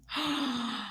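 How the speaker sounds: a sharp, breathy high gasp. Real, not acted. Reacting to Tiffany's comment with a soft gasp. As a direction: gasp in delighted shock.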